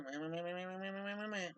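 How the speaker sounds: soft, taunting meh meh meh; mocking meh. Mocking the naggers he hears every day at home and online. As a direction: brush off the nagging with a mocking bleat. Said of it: "I hear that every day".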